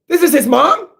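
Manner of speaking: high-pitched disbelieving question; astonished. Real, not acted. Reader learning who the boy's mom is, astonished. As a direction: shoot the pitch up in amazement.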